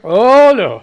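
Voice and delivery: loud, emphatic no. Real, not acted. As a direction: belt out the no.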